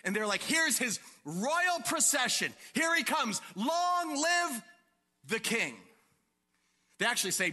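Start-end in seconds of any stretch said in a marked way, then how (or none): 0.4-5.8 high, slow proclaiming shout; crowd acclamation. Acted. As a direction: herald the arriving king. Acting the welcoming crowd.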